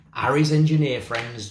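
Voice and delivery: ordinary delivery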